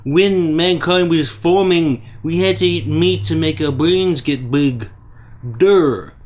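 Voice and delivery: slow, dragged-out dumb-person voice; Bearing. Acted. Mocking a rival's case for meat, slow dim-witted voice. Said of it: "mindset of an incredibly dumb person".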